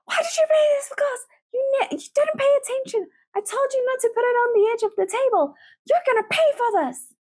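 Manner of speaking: high, fast, shrill-edged scolding; mock shouting parent. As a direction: rant at the child over the broken glass. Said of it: "instead of shouting".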